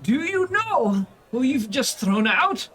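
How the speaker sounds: high, slow, affronted; indignant portly noble. Acted. Thrown-out noble scolding the tavern, indignant. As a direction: demand it like an outraged rich man. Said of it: "he just goes like".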